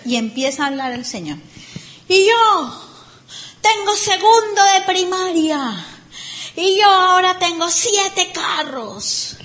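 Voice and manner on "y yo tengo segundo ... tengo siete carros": mock boastful speaker. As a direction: brag like a self-made man.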